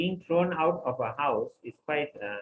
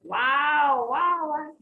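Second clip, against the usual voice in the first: much higher, louder whoops of joy; ecstatic cheering; 12 semitones higher, 7 dB louder, much slower than usual. Acted. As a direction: jump for joy on each wow. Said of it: "he started jumping and said".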